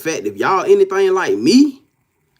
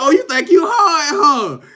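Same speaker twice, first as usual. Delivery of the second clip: taunting; 10 semitones higher than usual. Pretend. Mocking someone who thinks they're tough.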